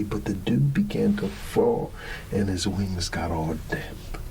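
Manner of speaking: usual speaking voice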